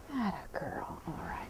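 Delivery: very soft whisper to the horse; whispered praise. Real, not acted. Trainer encouraging her mare to step forward, whispered. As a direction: breathe the praise gently.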